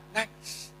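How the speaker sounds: nangis breathed out as a bare whisper, no voice; whispered weeping word. Acted. Preacher whispers that the Prophet wept, hushed whisper. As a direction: let nangis fall away into a whisper.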